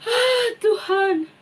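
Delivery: high, loud sobbing plea to God; crying prayer. Acted. King Hizkia weeping as he prays to God, high sobbing plea. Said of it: "Dia menangis adik-adik".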